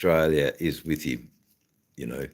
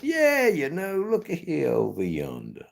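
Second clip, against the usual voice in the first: put-on Southern accent; 11 semitones higher than usual. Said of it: "and then just".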